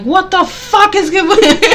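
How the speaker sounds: high, loud mock road rage shout; road rage yell. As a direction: yell at the other driver in a laughing fury. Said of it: "'cause I get road rage".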